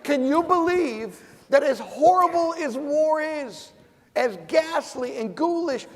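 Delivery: rally the room as you get fired up; impassioned speech rising in pitch. Real, not acted. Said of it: "I get fired up".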